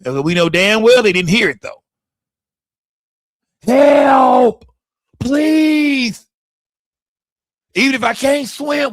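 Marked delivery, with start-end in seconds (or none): 3.6-8.9 high, drawn-out mock plea for help; acted desperate cry. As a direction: call out like a drowning swimmer. Acts drowning person calling for help.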